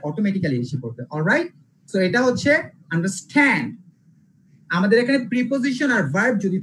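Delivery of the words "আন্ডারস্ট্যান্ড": আন্ডারস্ট্যান্ড with the weight on stand; stress on the second part. Shown as a demonstration. Teacher showing verb stress to students, আন্ডারস্ট্যান্ড stressed on stand. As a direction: lean hard on stand in আন্ডারস্ট্যান্ড. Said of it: "verb থাকলেই আমরা".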